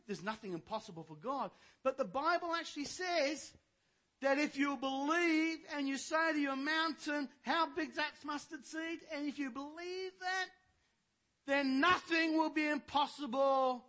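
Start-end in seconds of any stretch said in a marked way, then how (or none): 1.8-10.5 high, loud preaching build; raised preacher's voice, driving the scripture home; full-force preaching. Acted. 11.5-13.8 loud, high shout on nothing; preacher proclaiming scripture, shouting; shouted promise. Acted. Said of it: "Do we actually believe that?".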